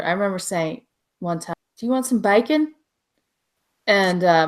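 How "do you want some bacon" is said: put-on Texas accent. Child asking Michigan cousins about food, Texas accent. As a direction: ask it with a Texas "bacon". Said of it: "my cousins wouldn't be able to under".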